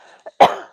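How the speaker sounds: loud harsh cough; sudden hacking cough; asthmatic cough. Real, not acted. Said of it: "Sorry, asthma".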